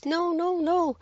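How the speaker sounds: very high, sing-out NO, NO, NO; high-pitched voice. Shown as a demonstration. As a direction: squeak the scolding way up high. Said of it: "always in a high-pitched voice".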